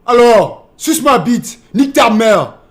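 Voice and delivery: loud, high French outburst; imitating French swearing. Shown as a demonstration. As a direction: hurl French insults as a showy demo. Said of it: "French people, they say".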